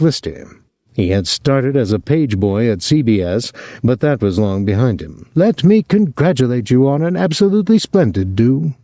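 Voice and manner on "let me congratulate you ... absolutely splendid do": effusive party guest. A narrator's reading. Effusive guest, like an English actor.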